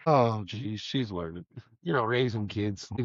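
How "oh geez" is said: ordinary talk, nothing marked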